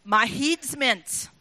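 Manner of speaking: slow broad Scots, drawn out; Scottish accent. Shown as a demonstration. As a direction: say it slowly like a puzzled Scot.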